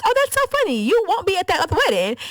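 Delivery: high, sarcastic laugh in the voice; mock amused. The bride mocks the maid of honor, high sarcasm. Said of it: "my friend was like".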